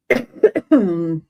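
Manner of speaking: harsh real cough; hacking cough; coughing fit. Real, not acted. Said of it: "I'm sorry I'm coughing so much".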